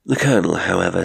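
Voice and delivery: ordinary delivery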